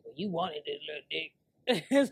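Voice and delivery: low, slow mock comeback; imitating the texter.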